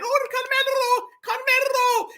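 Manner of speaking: high, gushing fan voice calling his name; swooning fan cheer. Retold or imitated. Fans in the audience swooning high over Carmelo. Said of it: "they over there saying".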